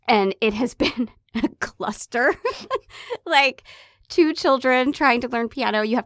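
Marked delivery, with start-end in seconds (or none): 2.4-3.2 short high burst of laughter; a squeaky real laugh at the chaos. Real, not acted. Laughing about piano chaos.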